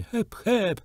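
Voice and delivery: high, thin calls to the horse; a little girl's high calls from the horse's back. A narrator's reading. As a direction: cry out in a tiny girl's voice. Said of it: "pokrzykiwała cienkim głosikiem Jenny".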